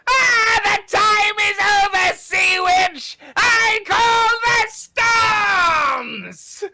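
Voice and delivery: high, loud, drawn-out villain shout; booming villain cry. Acted. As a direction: cry it to the storm. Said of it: "you see the Crawl Swarmer go".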